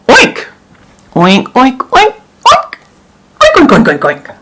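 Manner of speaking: high squealing pig noises; pig oinks. Acted. Farmer oinking at the judge.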